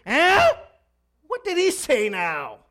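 loud, high-pitched hard-of-hearing holler; hammed-up yell across the car; yelling wife. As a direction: shout it as the wife who missed what was said. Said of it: "the wife started to yell again".

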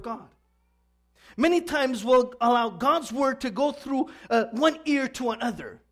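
loud, high-pitched sermon delivery; preaching. Real, not acted. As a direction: drive each verse home to the congregation. Preaches to congregation at raised force.

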